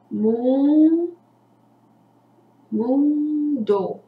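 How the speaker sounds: "mundo" drawn out, first mun alone, then mun-do; syllable by syllable. Shown as a demonstration. Teacher showing the word mundo, syllable by syllable. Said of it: "a palavra mundo tem duas sílabas".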